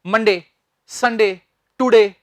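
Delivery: मंडे, संडे, टुडे with short cut-off de; abrupt endings. Retold or imitated. Teacher imitates our habit, मंडे, संडे, टुडे with abrupt short endings. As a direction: end मंडे, संडे, टुडे suddenly, no glide. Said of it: "हम इसे एकदम से बोल देते हैं".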